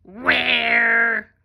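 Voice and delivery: big, loud monster voice. Shown as a demonstration. Teacher reading sight words with kids, monster voice. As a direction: drag it out loudly like a monster. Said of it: "use our monster voice".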